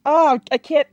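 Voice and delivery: ordinary talk, nothing marked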